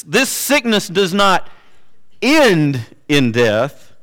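"end" set apart after a pause and drawn out; stress on END. Shown as a demonstration. Preacher showing congregation the most important word, stressing "end". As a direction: lean slowly on "end", not death. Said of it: "what's the most important word".

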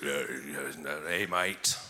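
put-on a deliberately terrible Aussie accent; bad Australian impression. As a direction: ham up a big bearded Australian guy. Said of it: "That's a terrible impression".

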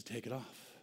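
soft, gentle command; low gentle voice of Jesus; hushed Jesus. Acted. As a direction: say it softly, like Jesus speaking. Jesus quoted softly.

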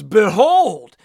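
loud, pompous Behold, then a slow you look good; a silly overblown greeting; mock grand pronouncement. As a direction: ham up an old-fashioned announcement. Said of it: "walks into a room and goes".

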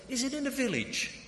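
very high-pitched, inquisitive question; keen, squeaky-high probing; closing in further. Retold or imitated. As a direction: act out homing in on the answer. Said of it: "we get closer and closer".

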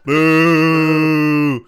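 long, very loud boo; crowd booing. Retold or imitated. Everyone turns on the comedian, loud drawn-out boo. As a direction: boo like a whole hostile audience.

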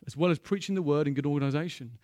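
ordinary talk, nothing marked

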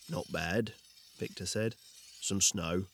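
low, clipped hero replies; terse low voice. Acted. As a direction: answer in a low, brief rumble. Victor answering tersely.

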